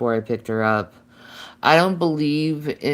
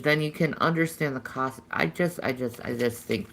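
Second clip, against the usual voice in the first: ordinary delivery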